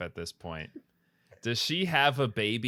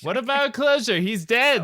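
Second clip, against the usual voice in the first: mock outrage; 12 semitones higher, 9 dB louder than usual. Mock-dramatic protest to Fran.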